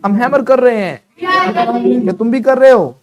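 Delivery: loud, high drill-master calls; projected, energetic classroom drilling. Shown as a demonstration. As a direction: call each sentence out for the class to answer. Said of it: "ऊपर रखो".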